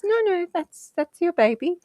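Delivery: high and gentle, insisting it's hers; imitating sonographer. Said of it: "And she goes".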